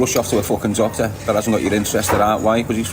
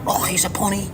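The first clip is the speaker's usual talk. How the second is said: a light sneering mock of the haters, a bit higher; 6 semitones higher, 5 dB quieter than usual. Streamer mocking what haters will say, lightly sneering. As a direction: lightly mimic someone taunting him. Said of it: "the haters are gonna run away with it".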